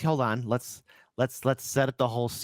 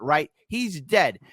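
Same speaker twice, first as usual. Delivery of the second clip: ordinary talk, nothing marked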